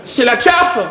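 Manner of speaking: high-pitched startled yelp; panicked cry. Acted. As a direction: blurt it out in sudden fright. Friend panics at alien.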